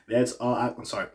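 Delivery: ordinary talk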